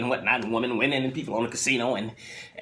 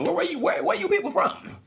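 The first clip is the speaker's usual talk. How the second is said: much higher, stammered question; high squeaky question. Retold or imitated. Photographer squeaks his question at the couple. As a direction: squeak out where they are from. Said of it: "And he says".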